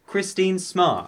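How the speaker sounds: slow, shouted winner's name; triumphant shout. Real, not acted. Announcing the top winner.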